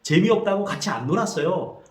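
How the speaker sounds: put-on older brother answering his mother; older brother's reply. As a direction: explain why he wouldn't play. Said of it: "그때 형이 말합니다".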